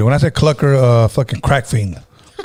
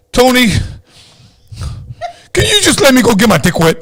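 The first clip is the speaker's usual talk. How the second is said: imitating Joe the crackhead; 10 semitones higher than usual. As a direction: beg like a desperate crackhead, voice cracking up. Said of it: "impersonation is on point".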